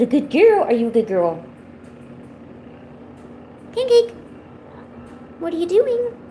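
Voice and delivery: high sing-song cooing at a cat; pet talk. Real, not acted. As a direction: coo at the kitty, pitch way up.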